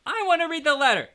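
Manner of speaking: very high, loud whiny demand; Dudley loudly demanding the letter. A narrator's reading. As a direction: demand it loudly like a spoiled child. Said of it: "he said loudly".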